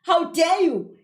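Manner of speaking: loud, high indignant outburst; angry scolding. A narrator's reading. Angry aunt tells off Kezia, indignant scolding. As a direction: scold with offended outrage. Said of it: "Usuke ecasukile lo muntu".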